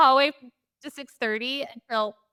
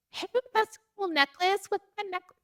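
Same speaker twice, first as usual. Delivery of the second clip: higher, chirpy, overly friendly small talk; chirpy husband imitation; 10 semitones higher than usual. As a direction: mimic someone cheerfully chatting up a stranger. Husband chatting up rental clerk.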